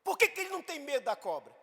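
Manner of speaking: high, startled question bursting out; sudden realization. Retold or imitated. A tourist in the group wondering aloud, high and startled. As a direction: stop short, struck by the thought. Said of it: "falou assim".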